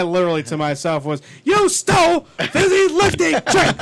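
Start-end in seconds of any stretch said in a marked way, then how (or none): 1.4-3.8 high, loud, slowed-down outraged shout; Willy Wonka. Retold or imitated. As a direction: yell the accusation in a mock fury. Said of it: "seeing him as Willy Wonka".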